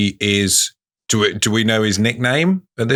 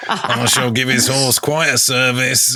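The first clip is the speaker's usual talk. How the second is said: saucy; 4 semitones higher than usual. Shown as a demonstration. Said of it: "gone a bit weird towards the end".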